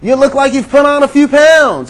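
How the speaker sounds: high, lightly voiced chirpy friend; tactless old friend. Preacher imitates a long-lost friend greeting, chirpy and high. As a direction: toss off a cheery backhanded remark. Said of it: "this long-lost friend comes across your path and says".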